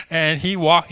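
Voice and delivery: ordinary talk, nothing marked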